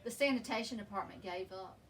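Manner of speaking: usual speaking voice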